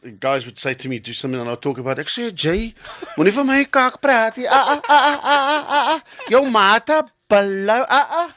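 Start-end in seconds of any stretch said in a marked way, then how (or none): 2.0-8.3 loud, high Cape Flats patter; Cape Town accent. Acted. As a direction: exaggerate the Cape Town slang, pitched up. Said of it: "when I come to Cape Town".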